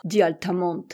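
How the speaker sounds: ordinary delivery, nothing marked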